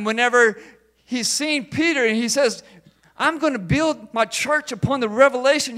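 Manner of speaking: loud, high preaching climax; full-force preaching. Acted. Preacher proclaiming Jesus' promise to the church, loud and fervent. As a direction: drive the promise home to the congregation.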